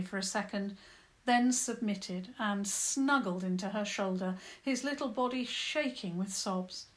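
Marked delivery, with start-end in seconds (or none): none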